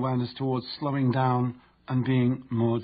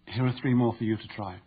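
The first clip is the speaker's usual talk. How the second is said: normal talking voice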